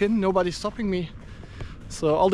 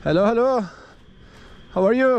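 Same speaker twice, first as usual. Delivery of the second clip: calling out hello; 5 semitones higher than usual. Real, not acted. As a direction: call out to the workers so they hear. Greeting stadium workers from afar.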